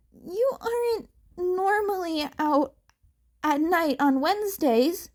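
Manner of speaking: high, rushed nervous stammering; flustered stammer. A narrator's reading. Izuku flustered in front of Mirko, high nervous stammer. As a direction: trip over every word, flustered.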